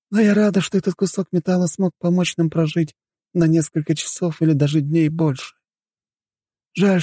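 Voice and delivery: high-pitched mother, resigned and reflective; wistful mother. Acted. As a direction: comfort your daughter while recalling a past life. (мать с тихой грустью, высоким голосом)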